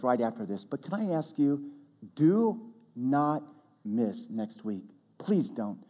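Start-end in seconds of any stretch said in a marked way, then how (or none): 2.1-4.8 slow, separate words on "do not miss"; earnest plea. Real, not acted. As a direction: space out "do not miss next week" deliberately. Pleading with congregation to return.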